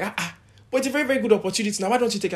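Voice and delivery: high, fast, pushy urging; hammed-up high coaxing; mock pushy adviser. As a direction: rush them like a nagging relative. Imitating people urging an opportunity.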